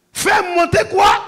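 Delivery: loud, slow, high call-out; preacher shouting to the congregation; shouted question. Real, not acted. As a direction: call it out to the crowd.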